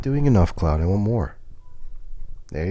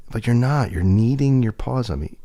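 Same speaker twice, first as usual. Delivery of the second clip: ordinary delivery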